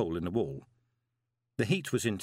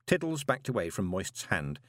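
ordinary delivery, nothing marked

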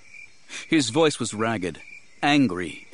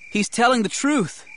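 put-on high-pitched boy's voice; boy calling out; 13 semitones higher, 4 dB louder than usual. A narrator's reading. Boy calls out to defend his rescuer, high and urgent. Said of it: "Terrell called".

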